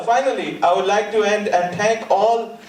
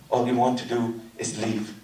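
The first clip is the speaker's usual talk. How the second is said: ordinary talk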